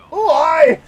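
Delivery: loud, hammed-up Scottish greeting; Scottish accent. Streamer greets a Scottish buddy in a loud mock Scottish accent. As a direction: shout it like a big Scottish lad.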